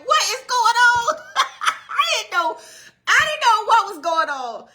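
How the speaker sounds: high, loud cry of disbelief with a laugh; astonished outcry. Retold or imitated. Preacher retelling her surprise at her parents' message, astonished outcry.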